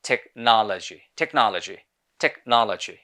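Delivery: American "technology", open NAH, falling end; American accent, unrounded stressed second syllable; American pronunciation. Shown as a demonstration. Teacher contrasts two accents, American second. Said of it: "American people এটাকে বলে".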